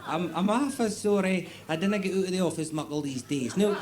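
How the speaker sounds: high-pitched, hesitant official, apologetic; comic high-voiced inspector, unimpressed; Department of Agriculture official.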